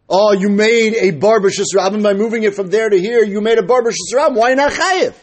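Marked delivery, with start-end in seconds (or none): none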